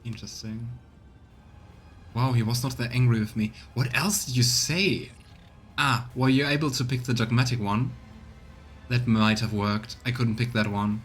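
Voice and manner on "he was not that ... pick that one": usual speaking voice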